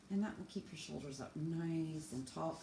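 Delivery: ordinary talk, nothing marked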